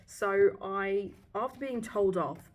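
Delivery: normal talking voice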